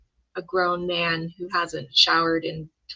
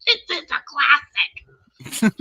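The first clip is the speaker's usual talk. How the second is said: high, very slow put-on granny voice; hammed-up old grandma, higher pitched; 6 semitones higher, much slower than usual. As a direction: draw it out like an old lady metal fan. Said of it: "I'm gonna be that grandma".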